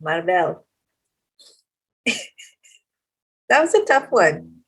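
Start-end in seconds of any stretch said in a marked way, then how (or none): 0.0-0.7 low MARVEL, said mar-VEL; Filipino pronunciation. Shown as a demonstration. Mom settles on the Filipino way, MARVEL as mar-VEL. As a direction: drop the pitch, MARVEL with a full "vel". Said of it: "if you're Filipino".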